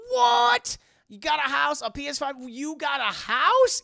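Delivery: loud, high disbelieving shout; astonished outburst. Real, not acted. Streamer hears friend bought a house, loud astonishment. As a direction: blurt it loud and high, stretching the last question.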